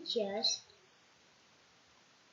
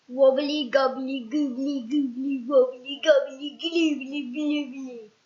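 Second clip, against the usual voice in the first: chanted goblin spell, louder; goblin magic words; 6 dB louder than usual. Acted. Goblin chanting his spell to change Mister Appleseed. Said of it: "Which sounded like this".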